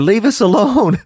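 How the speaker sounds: high playful plea; demon pleading. Shown as a demonstration.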